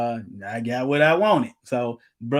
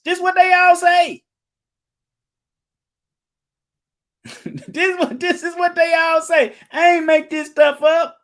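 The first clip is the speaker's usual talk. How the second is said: high-pitched emphatic shout, much louder; 12 semitones higher, 6 dB louder than usual. Real, not acted. Preacher to the congregation, a high ringing shout. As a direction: shout it up high to drive the point home.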